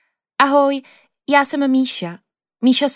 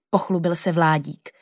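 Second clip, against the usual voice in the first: ordinary delivery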